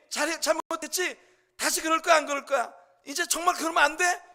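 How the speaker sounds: high, loud imitated mother's admonishing; mock maternal telling-off at full volume; parental scolding. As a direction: press the child for a promise, acted out. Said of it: "이렇게 말을 하면서 야단치는 일을".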